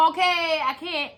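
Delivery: high, pleading cry of defeat; overwhelmed giving up. Retold or imitated. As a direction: act out reaching your limit, pitched high. Acts out giving up in pain.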